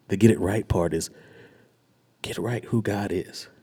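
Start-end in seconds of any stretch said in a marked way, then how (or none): none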